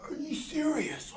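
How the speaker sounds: high, loud mock outrage; outraged disbelief. Boss reacting to free healthcare idea, high loud outrage. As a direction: shriek like you can't believe it. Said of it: "I'm like".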